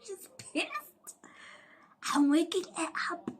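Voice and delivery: hushed gossip whisper; whispered aside. Real, not acted. Telling viewers the nurse is annoyed, whispered. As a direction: whisper it like a secret. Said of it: "aside to viewers".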